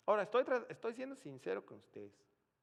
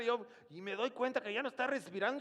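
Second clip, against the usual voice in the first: panicked aside; 8 semitones higher, 6 dB louder, faster than usual. Retold or imitated. Panicked aside amid the prayer.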